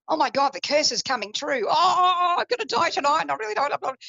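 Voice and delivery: put-on high, frightened old man fearing the curse; retold panic. Grandfather panicking to his doctor, high and frightened.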